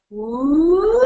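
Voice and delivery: long rising siren whoop; high whoop. Shown as a demonstration. Presenter showing a vocal warm-up, high whoop. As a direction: swoop loudly up into the top of the range. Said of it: "getting really high into that upper range".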